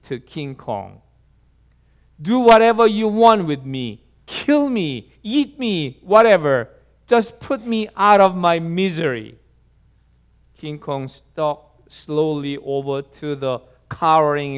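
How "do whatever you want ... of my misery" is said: louder, higher desperate begging; despairing plea. Acted. Cornered explorer begging King Kong to end it, desperate plea. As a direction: plead loudly in mock despair, pitched up. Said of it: "sank to his knees in despair and pleaded".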